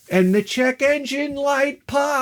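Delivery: high, drawn-out singsong reveal; dramatic reveal. Retold or imitated. Narrator reveals engine light coming on, high drawn-out wail. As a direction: raise the pitch and stretch out POPS. Builds to the disaster.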